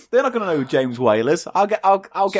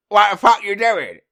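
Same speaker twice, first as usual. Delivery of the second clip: higher, put-on mean cat voice; Eli the Cat; 6 semitones higher than usual. Spiteful cat confronting the duck, put-on cat voice. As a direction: challenge the duck in a silly cat voice. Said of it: "Eli the cat said".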